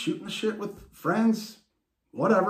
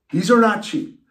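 quoting dad; 4 semitones higher, 5 dB louder than usual. Retold or imitated. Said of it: "was told a few times by Papa Bear".

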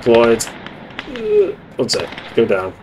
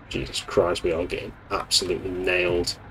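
muttered despair; 3 semitones lower, 9 dB quieter than usual. Real, not acted. Despair under breath.

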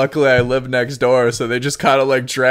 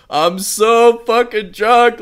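put-on drunk slurring; 9 semitones higher, 4 dB louder, slower than usual. Drunk text to crush.

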